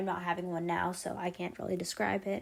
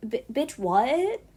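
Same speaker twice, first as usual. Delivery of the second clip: raised, louder retort rising on the last word; mock incredulous; 6 semitones higher, 6 dB louder, slower than usual. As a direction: throw back an incredulous comeback. Acts out reaction to mocking accent.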